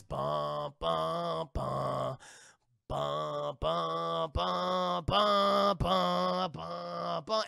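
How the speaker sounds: chanting bum bum like movie music; imitating the soundtrack as skeletons walk underwater; sung bum-bum score. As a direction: hum the ominous soundtrack beat by beat.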